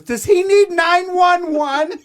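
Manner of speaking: put-on high, loud, slow alarmed girl. Gym girl asking if he needs help, high alarmed voice. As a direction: squeal it like a worried gym girl. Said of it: "one of the girls goes".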